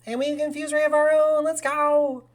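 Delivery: high, loud hype call; triumphant cheer. Real, not acted. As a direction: crow over your own move. Celebrating a move in the game.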